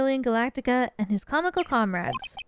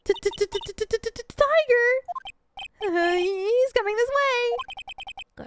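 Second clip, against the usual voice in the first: high, acted fright on a long stutter; panicked stammer; 11 semitones higher, much slower than usual. Maya warns Nick of a tiger coming, panicked. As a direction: stammer in alarm, then stretch the warning out high.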